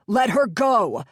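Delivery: loud, furious demand to release her; shout. Acted. Sykes yelling at the pirate holding Jennifer, furious shout. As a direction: bellow at the kidnapper.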